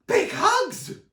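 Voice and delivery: very high, loud excited yell; Sonny shouts with glee to everyone, very high loud yell. A narrator's reading. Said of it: "Sonny shouted excitedly".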